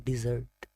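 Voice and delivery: डेजर्ट said "di-ZURT", like the sweet; dessert pronunciation. Shown as a demonstration. Teacher names the sweet after meals, dessert pronunciation. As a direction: make the first vowel of डेजर्ट a short "i". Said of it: "मीठा खाते हैं उसको कहते हैं".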